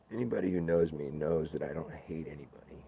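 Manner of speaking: usual speaking voice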